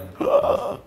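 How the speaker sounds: voiceless gasp of amazement; acted-out gasp. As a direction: gasp as if stunned by the money. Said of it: "I was like".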